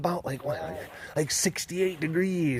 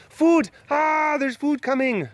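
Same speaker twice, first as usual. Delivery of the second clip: excited call; 11 semitones higher, 4 dB louder than usual. Real, not acted. Calling out to excited cats.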